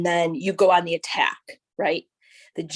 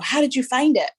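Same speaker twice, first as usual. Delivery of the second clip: a lifted, eager question; curious friends; 7 semitones higher, 4 dB louder than usual. Retold or imitated. Friends asking her how she found the lump, with mild surprise. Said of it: "so many people were like".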